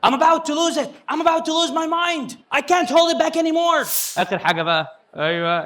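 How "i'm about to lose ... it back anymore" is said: mock exasperation. Shown as a demonstration. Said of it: "إبراهيم فايق كان بيقول".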